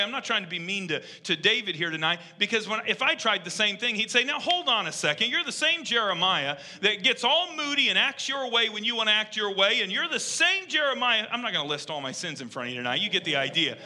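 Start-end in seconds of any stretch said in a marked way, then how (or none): 4.2-11.3 higher-pitched, pointed calling-out; lightly acted scolding, listing the faults; mock reproach. God challenging Jeremiah, acted quote.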